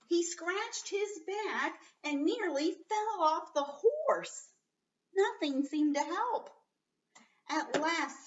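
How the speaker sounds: teacher acts out the itchy knight for the class; lively story reading. Acted. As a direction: play up the itching for the children.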